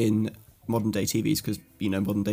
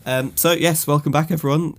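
upbeat host welcome, higher and louder; show intro; 5 semitones higher, 6 dB louder than usual. Real, not acted. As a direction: open the show with energy. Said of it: "podcast opening".

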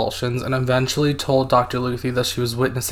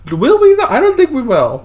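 playful high tease; 9 dB louder than usual. Acted. Teasing doubt about finding out.

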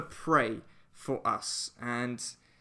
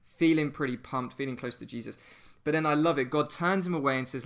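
normal talking voice

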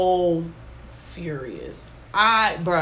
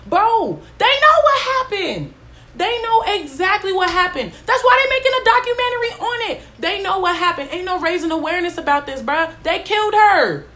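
high, loud outraged shouting; furious rant; 11 semitones higher, 5 dB louder than usual. Real, not acted. Vlogger raging at documentary makers, high loud fury. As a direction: blast them with disbelief and anger.

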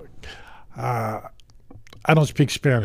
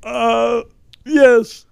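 high, halting presidential impression; Bill Clinton; 8 semitones higher, 4 dB louder, much slower than usual. Guest giving the podcast a shout-out, presidential impression. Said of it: "Yes, Bill Clinton".

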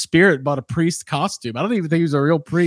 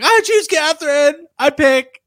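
a hint of a frantic dad; high mock dad; 11 semitones higher, 4 dB louder than usual. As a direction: squeak it out high. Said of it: "the one dad's like".

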